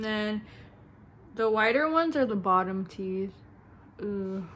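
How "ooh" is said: ordinary talk, nothing marked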